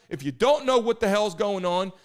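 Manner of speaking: usual speaking voice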